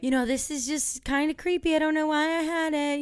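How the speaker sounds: high, sweet imitated aunt; sweet relative impression. Voicing sweet aunt and uncle.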